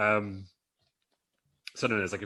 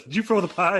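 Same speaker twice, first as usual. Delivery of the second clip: mock demanding; 10 semitones higher, 7 dB louder than usual.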